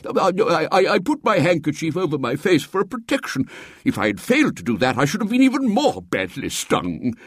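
high, stammering pompous cleric; fussy bishop. A narrator's reading. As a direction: bluster about your clever precaution. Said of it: "snapped the bishop".

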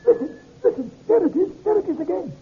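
high, terrified whisper-urgent voice; abject fear. Acted. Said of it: "a look of the most abject fear".

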